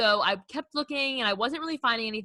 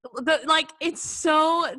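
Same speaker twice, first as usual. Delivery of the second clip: normal talking voice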